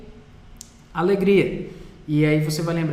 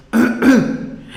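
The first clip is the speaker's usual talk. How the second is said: a loud harsh rasp from the throat, like a cough; throat-clearing rasp; 7 semitones higher, 8 dB louder than usual. Shown as a demonstration. Teacher shows the throat sound for ri: loud harsh rasp. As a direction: hack from the throat to show the sound. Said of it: "como se você tivesse ruim aí da garganta".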